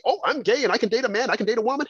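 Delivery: high, quick mocking singsong; mocking imitation. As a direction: rattle it off high, mimicking someone carefree. Mocking imagined quote in a rant.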